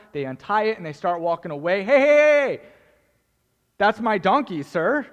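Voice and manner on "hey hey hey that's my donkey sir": loud, high outraged yell; shouting owner. Acted. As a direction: call out after the donkey thieves.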